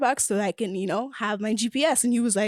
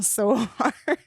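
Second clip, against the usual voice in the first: slow, breaking into a real laugh. Real, not acted. Telling friends about driving home after the date, laughing. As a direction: let the laugh take over the last word.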